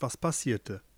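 ordinary delivery, nothing marked

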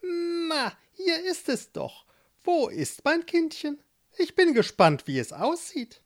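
put-on high, sly old witch. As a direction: play the witch, curious and pleased. Witch voiced in story.

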